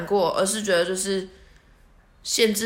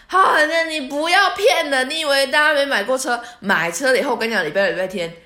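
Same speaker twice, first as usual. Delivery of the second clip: stern scolding; 7 semitones higher, 6 dB louder than usual. Retold or imitated. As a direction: call out her excuse firmly. Driver scolding, calling her bluff.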